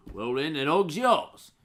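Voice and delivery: dog seller voice. Acted.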